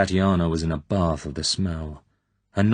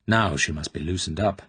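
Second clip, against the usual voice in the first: ordinary delivery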